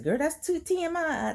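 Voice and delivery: high-pitched mock mom objection; imitating mom. Mom objecting to oversharing, high mock scolding. Voicing mother's likely objection.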